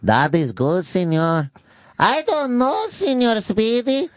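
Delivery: high-pitched, slow mock Mexican maid accent; Spanish accent. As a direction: squeal it in a hammed-up Spanish accent. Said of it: "Can you do a Spanish accent".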